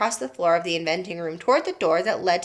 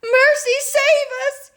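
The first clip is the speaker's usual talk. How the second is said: very high, slow and loud cry; a mother wailing to heaven; yelled; 6 dB louder, slower than usual. A narrator's reading. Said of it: "yelled Missus Beauregard".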